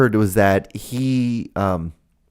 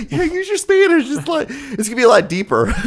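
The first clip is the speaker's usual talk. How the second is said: high laughing voice. Real, not acted. Host laughing at his own joke about cursing. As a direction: giggle it out.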